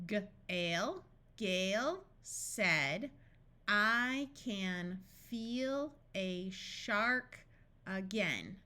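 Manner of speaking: very slow, with gaps between words; deliberate, spaced-out practice reading. Shown as a demonstration. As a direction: sound out the name, then read each word apart. Practice sentence read word by word.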